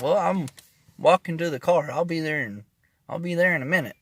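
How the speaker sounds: calling back while walking fast; loud and hurried. Retold or imitated.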